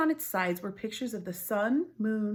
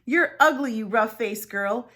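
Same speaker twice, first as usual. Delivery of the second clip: loud, high, jeering taunt; mocking sisters; 3 semitones higher, 10 dB louder than usual. Older sisters jeering loudly at the scarred girl. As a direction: sneer it out like a cruel sister. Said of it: "those two older sisters laughed at her".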